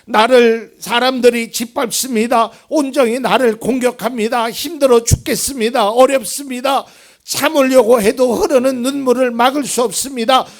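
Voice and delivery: loud, high, pleading cry to God; David's desperate plea. Acted. Preacher voicing David crying out to God, loud and desperate. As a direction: voice David begging God to see his tears. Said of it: "그래서 다윗은".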